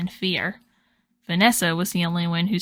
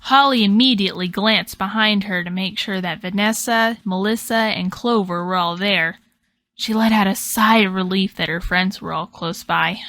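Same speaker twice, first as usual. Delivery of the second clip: plain reading voice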